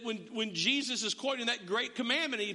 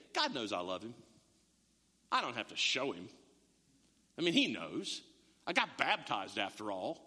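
much lower, self-assured inner voice; complacent believer; 10 semitones lower than usual. Retold or imitated. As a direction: drop low and smug, sure God knows. Christians' smug inner thought voiced.

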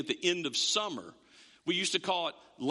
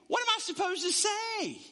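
high, worried plea of a lost man; panicked what do I say; anxious Moses; 13 semitones higher than usual. Acted. Moses asking God what to say, high and worried.

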